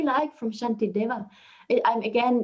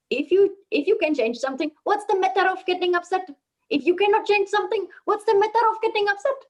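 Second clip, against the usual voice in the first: put-on higher, lilting Indian-accented recitation; 7 semitones higher than usual. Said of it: "I say it in the Indian accent".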